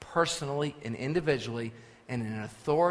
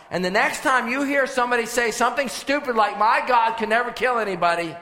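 loud, raised preacher's voice building in force; forceful scripture preaching; 6 semitones higher, 7 dB louder than usual. Acted. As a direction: preach the verses out, driving up to full volume. Reads scripture at full preaching force.

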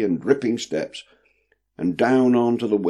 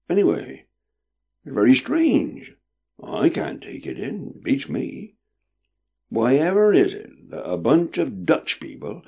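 put-on puzzled old sailor, higher pitch; wondering; 6 semitones higher than usual. A narrator's reading. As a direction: wonder aloud, baffled. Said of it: "with a wondering sound to his voice".

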